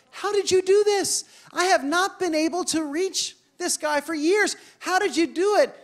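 pitched high in disbelief; amazed question. Retold or imitated. Pastor amazed, asking the girl how she did it. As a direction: marvel at how she managed it.

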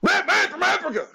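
high, fast mock exclamation; hammed-up high outburst; mock exclaim. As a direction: shriek it like a silly bystander. Mocks French claim.

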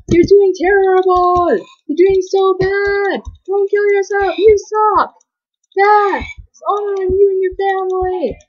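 high drawn-out heckling wail; imagined screaming. Retold or imitated. Player voicing viewers screaming at their screens, high mock heckling. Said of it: "probably screaming at me like".

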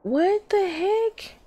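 hammed-up squeaky disbelief; exaggerated disbelief. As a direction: stretch it out in mock outrage. Repeats the line, exaggerated.

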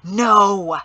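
curt, cold, furious no; brittle fury. A narrator's reading. Father silences family, brittle fury. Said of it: "with such brittle fury".

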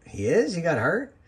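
high, startled double-take, imitating Daniel Bryan; surprised. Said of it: "Daniel Bryan went".

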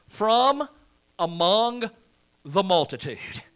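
"from" lifted high, each word set apart by pauses; "from among the multitude" spaced out word by word; slow, deliberate emphasis on "from"; stressed phrase. Shown as a demonstration. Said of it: "point out four words in this verse".

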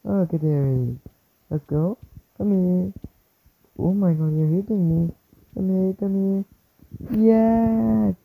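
loud hype shouts mid-fight; hyped yelling. Real, not acted.

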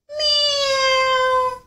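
slow high drawn-out meow; stretched meow. Acted. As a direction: sing the meow out like a cat. Cat demonstrates the meow.

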